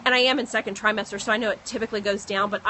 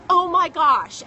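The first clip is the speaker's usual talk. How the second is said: amazed gasp; 7 semitones higher, 6 dB louder, slower than usual. Retold or imitated. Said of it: "her face is just like".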